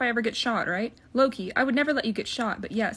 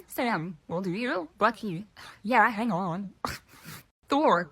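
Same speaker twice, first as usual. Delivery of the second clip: ordinary talk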